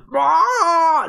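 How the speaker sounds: high, loud squawking roar like a broken chicken; terrible dragon roar. Retold or imitated. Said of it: "that's the dragon noise".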